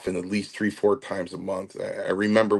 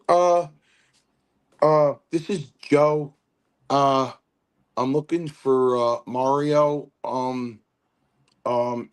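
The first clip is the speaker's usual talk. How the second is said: higher, halting phone-message imitation; Chief; 5 semitones higher than usual. Chief leaving a message for Mario, imitated high halting voice. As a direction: leave a hesitant voicemail in a raised voice. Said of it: "And he goes".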